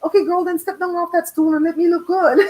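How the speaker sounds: mock sassy. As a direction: snap back playfully at her. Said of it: "I'm like".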